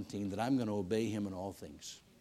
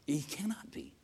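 whisper; 3 semitones higher, 4 dB quieter than usual. Real, not acted. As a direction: breathe it out like a secret conviction. Insisting God cannot be against.

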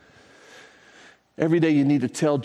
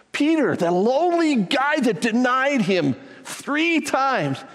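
impassioned preaching; 10 semitones higher, slower than usual. Acted. Preacher pleading about Peter.

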